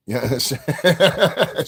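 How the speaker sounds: drawn out through a high laugh; laughing hard at his own joke. Real, not acted. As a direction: squeeze the word out while cracking up. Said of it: "laughing at his own joke".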